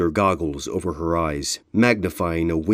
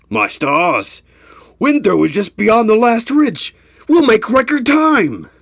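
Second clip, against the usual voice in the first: much higher, loud joyful exclamation; elated; 7 dB louder than usual. Acted. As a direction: burst out with joy. Elated pony after crossing.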